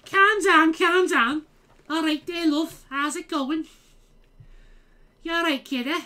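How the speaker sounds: high, loud-starting caricature Scouse; fake Scouse accent. Greeting a kiddo for laughs, exaggerated Scouse. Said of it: "I can't fucking do the Scouse accent".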